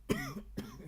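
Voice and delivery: sharp dry cough; coughing on dust. Real, not acted. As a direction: cough hard from dust in throat. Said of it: "I got, like, dust in my throat".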